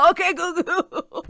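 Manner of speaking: Italian grandma saying Okay goo goo; Italian grandma accent. Retold or imitated. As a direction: mimic a confused Italian nonna. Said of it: "mimicking what her accent was like".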